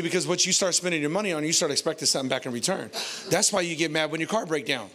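ordinary talk, nothing marked

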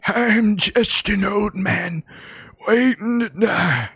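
high, slow, put-on old-man voice; mock old man. Player waiting around for action, mock old-man voice. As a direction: drag it out as a hammed-up old man.

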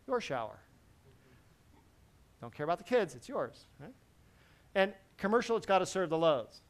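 ordinary delivery

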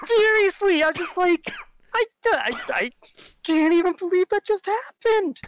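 high, loud disbelieving exclaim; incredulous. Real, not acted. Recalling the kicked bubble tea, high loud disbelief. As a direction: squeal it out in total disbelief.

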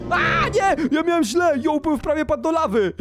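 high cry of alarm; panicked yelp. Real, not acted. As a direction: yelp at a near fall. Nearly falls into lava.

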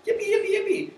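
bubbly app thrilled by a thumbs up; gushing excitement. Retold or imitated. App thanking the user for a thumbs up, high giddy glee. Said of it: "it has that tone".

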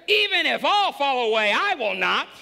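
loud, high, proud vow; bold declaration. Acted. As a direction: belt it out like a boastful disciple. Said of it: "Peter declared".

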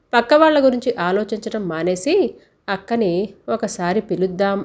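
ordinary delivery

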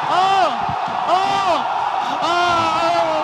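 loud rising oh-oh cheers; excited shouting. Real, not acted. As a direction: cheer the big punch. Fighter knocked out of ring. (seruan heboh yang keras)

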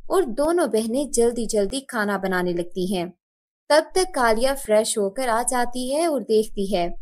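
ordinary delivery

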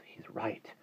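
hushed, voiceless whisper. A narrator's reading. Jane admits it to her brothers, whispering. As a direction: whisper it under your breath. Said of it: "Jane whispered".

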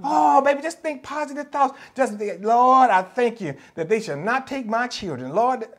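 high, sing-song, syrupy mockery; sugary mock reassurance aimed at the widow; mock sweet preacher. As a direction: parody a preacher's empty comfort. Mocking a feel-good preacher.

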